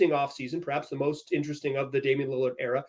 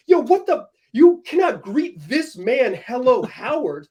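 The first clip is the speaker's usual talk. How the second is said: higher, louder, slow incredulous protest; mock disbelief; 7 semitones higher, 5 dB louder, slower than usual. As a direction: act stunned at the greeting. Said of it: "and I'm like".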